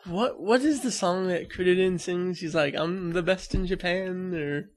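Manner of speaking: normal talking voice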